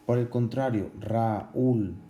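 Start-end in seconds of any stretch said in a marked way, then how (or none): 1.0-2.0 "Raúl" split into ra-úl with weight on the ú; stressed hiatus. Shown as a demonstration. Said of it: "la fuerza está en la U".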